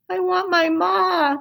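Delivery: slow, high and pleading; a lost child crying for her mother; cried out. A narrator's reading. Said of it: "cried Mig".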